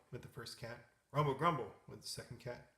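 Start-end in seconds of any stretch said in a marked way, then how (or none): 1.1-1.7 loud mock snore spoken as words; snoring cat. A narrator's reading. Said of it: "The two cats began to snore".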